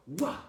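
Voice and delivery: loud, high "wah" for a blow; explosive fight sound effect; imitated kick impact. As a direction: burst out like a kick landing.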